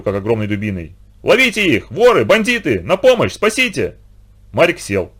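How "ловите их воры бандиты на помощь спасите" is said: terrified shouting. A narrator's reading. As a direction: yell for help in sheer terror from a nightmare. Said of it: "в ужасе размахивать виолончелью". (испуганный крик, выше и громче)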